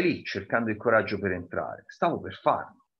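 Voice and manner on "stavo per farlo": plain reading voice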